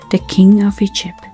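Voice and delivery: plain reading voice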